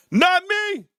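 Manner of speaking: high squeaky not me; squeaky denial. Real, not acted. Host jokingly denying he's envious, high squeal. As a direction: squeal it up high in mock innocence.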